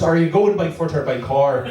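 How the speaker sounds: helpful local in a put-on Irish accent; comic Irish accent. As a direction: ask it with a mock Irish lilt. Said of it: "this guy was Irish".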